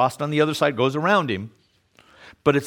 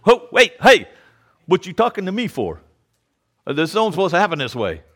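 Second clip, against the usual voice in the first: startled woman; 4 semitones higher than usual. Retold or imitated. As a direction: voice her sudden shock at being addressed. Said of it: "her response back is, says".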